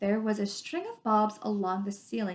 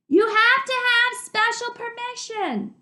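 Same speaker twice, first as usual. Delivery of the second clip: high and loud, slowed, raised over the roar; shouting over the river; 14 semitones higher, 7 dB louder than usual. Acted. Dune shouting his question to Arlen over the water. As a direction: keep shouting across the noise.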